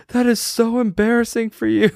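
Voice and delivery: high, mocking cry through laughter; imitated hysterical laughing. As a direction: tease as if helpless with laughter. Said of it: "bawling his eyes out, laughing".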